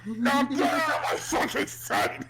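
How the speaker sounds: high, loud hammed-up yell; mock furious shout. Reenacting the rant for friends, a hammed-up furious shout. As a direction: snap at the baby in a cartoon rage. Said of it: "so quiet but still angry".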